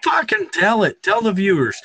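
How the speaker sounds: high, eager egging on; excited urging. Real, not acted. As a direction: egg him on. Urging friend to say it.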